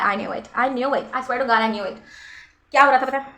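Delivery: ordinary delivery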